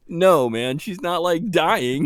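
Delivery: lightly voiced bro reassurance; imitating Matt. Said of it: "Matt's like".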